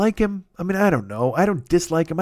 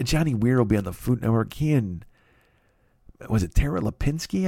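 ordinary talk

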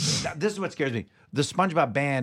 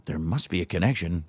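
suspicious inner voice; 10 semitones lower than usual. Acted. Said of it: "but you're going".

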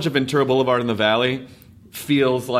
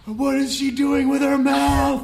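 high, drawn-out mock bewilderment; baffled Americans; 13 semitones higher, much slower than usual. Host voicing Americans puzzled by the singer, high bewilderment. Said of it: "Americans are like".

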